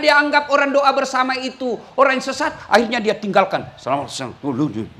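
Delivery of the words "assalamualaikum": low, soft, mumbled greeting; mumbled salam. Retold or imitated. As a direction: mumble it while slipping away. Mocking a leaving worshipper. (salam digumamkan pelan)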